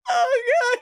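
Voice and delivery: very high, tearful laughing gasp; real laughter close to crying; helpless laughing. Real, not acted. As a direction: squeak it out mid-laugh. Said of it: "I am laughing".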